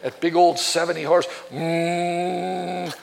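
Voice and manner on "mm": low, steady engine drone; long, soft, level "mm"; imitated motor hum. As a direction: hum like a big outboard motor. Imitates a big motor's noise.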